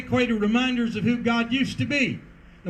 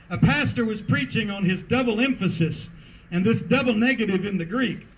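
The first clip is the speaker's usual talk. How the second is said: usual speaking voice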